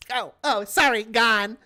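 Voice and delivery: quick dismissive flick of a voice; sassy snap. Retold or imitated. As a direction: toss it off like a sassy Karen. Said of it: "it went just like this".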